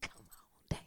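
soft whispered call; calling Malaysia down quietly. Real, not acted. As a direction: beckon her in a whisper.

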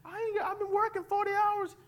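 high, fast, whimpering excuse to the wife; whiny pleading husband. Pretend. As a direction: plead like a broke husband near tears. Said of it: "going to my wife and saying".

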